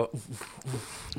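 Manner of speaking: soft imitated grunts. Imitating the man's grunts while training.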